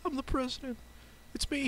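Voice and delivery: high, shy little voice; bashful Samuel L. Jackson. Pretend. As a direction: say it timidly, way up high. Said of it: "Sammy, you sound very bashful".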